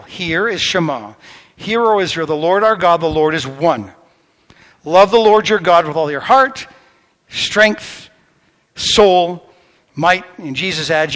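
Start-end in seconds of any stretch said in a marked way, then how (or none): 1.6-10.2 proclaiming. Acted. Preacher proclaiming the Shema to the congregation, loud. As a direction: declare it to the whole room.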